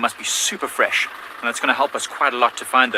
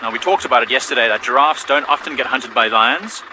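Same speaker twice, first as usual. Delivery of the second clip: ordinary delivery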